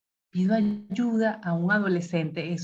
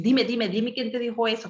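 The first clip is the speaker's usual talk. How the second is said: ansioso; 4 semitones higher than usual. Shown as a demonstration. Padre sobreprotector acelerado.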